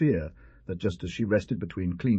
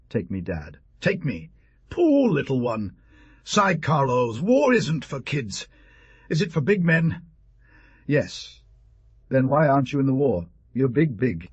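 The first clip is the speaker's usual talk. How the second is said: a hint of a pleading child, raised pitch; animated story dialogue, lifted and louder in places; lightly voiced eager boy; 4 semitones higher, 3 dB louder than usual. A narrator's reading. Boy begging his father to take him to war, lightly acted eagerness.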